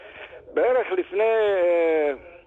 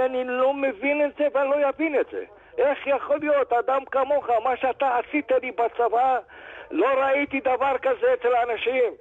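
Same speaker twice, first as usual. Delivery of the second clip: higher, tearful pleading, lightly imitated; retold crying; 7 semitones higher than usual. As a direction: imitate a rich man half-crying in disbelief. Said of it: "הבן אדם התחיל לבכות". (חיקוי קל של בכי, בקול גבוה ומתחנן)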